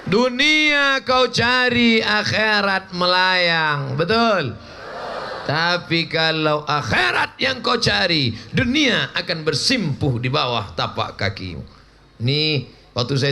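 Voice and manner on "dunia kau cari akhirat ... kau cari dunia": slow, weighty sermon line; preaching emphasis. Acted. Preacher driving home the lesson, slow. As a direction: lay down the moral slowly.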